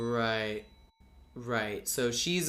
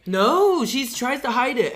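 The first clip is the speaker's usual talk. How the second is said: high, loud eager correction; excited correction; 11 semitones higher, 8 dB louder than usual. Real, not acted. Correcting a co-host's recap, high and loud. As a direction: jump in to correct with a squeal.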